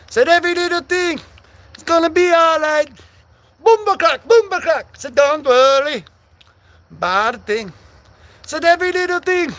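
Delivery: high, half-sung mock Jamaican; Jamaican accent. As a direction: go full Jamaican, loud and singsong. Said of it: "I gotta go full Jamaican mode".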